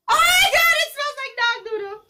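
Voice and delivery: loud, very high outburst; high-pitched grossed-out wail; disgusted squeal. Real, not acted. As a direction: shriek in horror at the stink. Recoiling at a disgusting smell.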